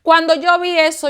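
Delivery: normal talking voice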